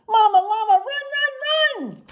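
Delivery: put-on high, loud child's scream; baby llama scream. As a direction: scream for mama like a scared little one. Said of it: "That's a loud scream there".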